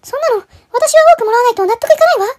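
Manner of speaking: high greedy sister voice, insistent; shrill sister demanding. Acted. Sister arguing with brothers, high demanding voice. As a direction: play the sister claiming the bigger share.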